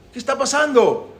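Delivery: agudo y desconcertado; acted alarm, pitched high; marido alarmado. Esposo alarmado llama a su esposa. (marido alarmado, voz aguda)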